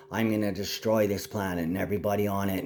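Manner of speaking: ordinary talk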